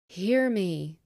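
loud commanding call; ghostly cry. A narrator's reading. Marley's ghost cries out to Scrooge, loud and commanding. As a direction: demand to be heard. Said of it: "cried the ghost".

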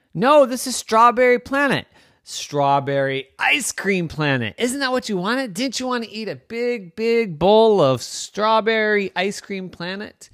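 high, excited, emphatic gushing; eager insistence. Acted. Comet insists this is Strawberry Ice Cream Planet, excited. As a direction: gush over the treat as if it's obvious.

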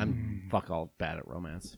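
low seductive voice, drawn out; sexy voice. Pretend. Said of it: "we're doing our sexy voices".

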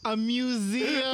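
slow, drawn-out disbelief; incredulous echo. Real, not acted. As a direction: stretch the word museum in disbelief.

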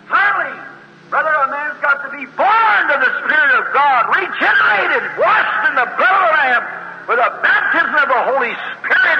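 preacher exhorting about new birth; preaching at full force. Real, not acted. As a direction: ring it out to the congregation. Preacher's climax.

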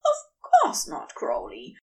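an angel bluffing that he's fine; high, flustered insistence; lying angel. A narrator's reading. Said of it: "Aziraphale lies".

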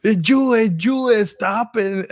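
high, loud mock scolding of the kids; imitating angry mom. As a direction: shriek it like a furious grandmother. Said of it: "she's getting even more angry".

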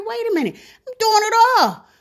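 lightly acted, high-pitched indignant protest; exasperated protest. Fed-up man's quoted protest.

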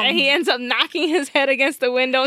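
ordinary talk

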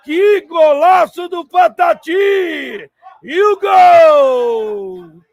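full-throated goal scream, high and drawn out; explosive goal commentary. Real, not acted. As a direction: stretch the goal call as long as your lungs allow. Goal scored, commentator erupts. (narração de gol explosiva)

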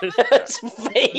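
slow, giggling "veiny"; laughing. Real, not acted. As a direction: laugh through the word slowly. Reacting to the burrito.

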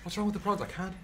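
put-on South African accent. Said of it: "Damn South African accent".

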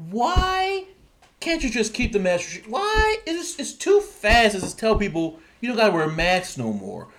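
very high-pitched exasperated questioning; incredulous outcry. Real, not acted. Host questioning governors on masks, high and incredulous. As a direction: shoot the why way up high in disbelief. Incredulous at lifting mask rules.

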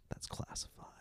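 a secretive, hushed whisper. Retold or imitated. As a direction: breathe it as a classified secret. Secretive answer to the seals.

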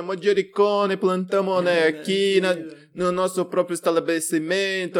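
hammed-up Italian chef accent. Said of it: "começa a falar até com sotaque italiano".

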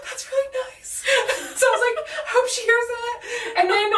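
high, teary laughter while retelling, half crying; laughing through tears. Real, not acted. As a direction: laugh and cry at once while telling it. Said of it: "I start crying harder".

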